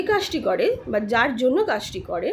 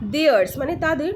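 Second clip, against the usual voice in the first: usual speaking voice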